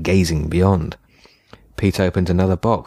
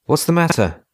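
alarmed; 10 semitones higher than usual. A narrator's reading. Alarm at Pete.

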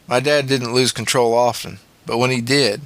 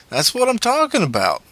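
put-on excited boy; 10 semitones higher than usual. A narrator's reading. Wolf voiced by narrator.

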